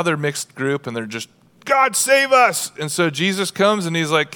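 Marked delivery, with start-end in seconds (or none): 1.7-2.7 high, drawn-out plea for rescue; crying out. Acted. Outcast lepers begging God for help, crying out. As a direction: cry it out slowly, pitched up. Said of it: "they're crying out".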